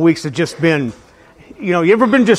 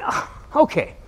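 1 breathy resigned okay; 5 semitones higher, 8 dB quieter than usual. Retold or imitated. Resigned reply to God.

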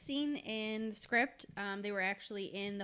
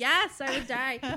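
mortified; 5 semitones higher, 10 dB louder, slower than usual. Real, not acted. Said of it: "You'd be embarrassed?".

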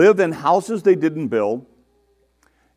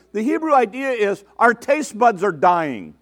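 ordinary talk

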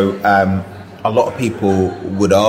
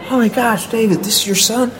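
much higher, fast, bright cartoon cowboy voice; Woody voice; faster than usual. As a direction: Tom Hanks doing Woody to win over a shy kid. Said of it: "he was gonna do the whole Woody accent thing".

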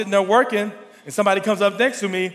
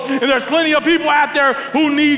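louder, higher preaching drive; full preaching; 6 semitones higher, 4 dB louder than usual. Acted. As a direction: push the call to serve at the crowd. Preaching to congregation.

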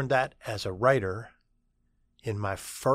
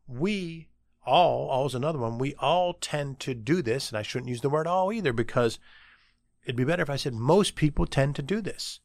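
normal talking voice

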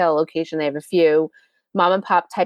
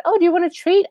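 pitched way up, chirpy treat offer; high sing-song dog talk; 11 semitones higher, 3 dB louder, faster than usual. Retold or imitated. Young employee sing-songing up high to the dog. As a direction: squeak it like a giddy puppy lover. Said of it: "And she said".

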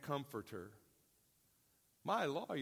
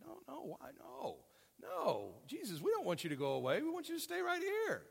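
high-pitched, mock pleading protest; protesting disciples; 9 semitones higher, 3 dB quieter than usual. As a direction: play followers begging their teacher to stay. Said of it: "imagine them shaking their heads going".

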